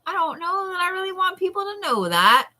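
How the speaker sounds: a light imitation of a reluctant client; hesitant inner voice. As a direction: mimic your own unsure thoughts. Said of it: "and like".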